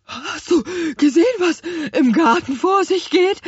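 indignant little apple man, high and loud; out of breath, fast and outraged; Spargelzahn. A narrator's reading. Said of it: "Spargelzahn war sehr empört". (atemlos und sehr empört)

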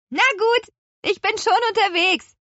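very high, loud witch's call. A narrator's reading. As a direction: sing out an answer from above. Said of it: "rief Petronella".

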